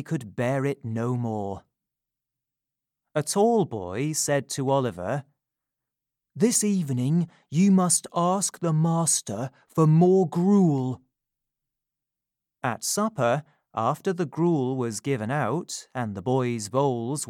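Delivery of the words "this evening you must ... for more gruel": higher, eager schoolboy; urging, conspiratorial boy; older boy voice. Acted. Tall boy urges Oliver.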